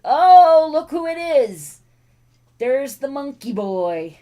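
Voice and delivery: loud, high-pitched welcome; delighted pet greeting. Real, not acted. Owner greets second dog coming in, delighted. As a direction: burst out high at a pet arriving.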